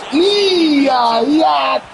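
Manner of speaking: high yells urging the catch; excited calling out. Real, not acted. Fan yells at the screen as the fly ball drops. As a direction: cry it out like willing the ball down.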